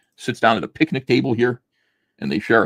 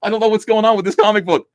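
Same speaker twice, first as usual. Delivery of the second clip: higher, louder baffled voice; a character wondering about a missing word balloon, baffled; mock confused character; 11 semitones higher, 6 dB louder than usual. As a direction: play him lost and puzzled.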